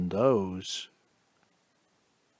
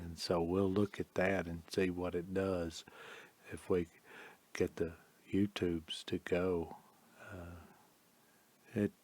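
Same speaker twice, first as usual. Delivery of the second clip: muttering aside; 4 semitones lower, 10 dB quieter than usual. Real, not acted. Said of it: "fading to whisper while setting video".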